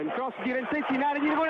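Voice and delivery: ordinary talk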